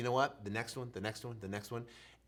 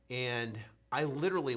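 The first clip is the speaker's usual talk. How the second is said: ordinary talk, nothing marked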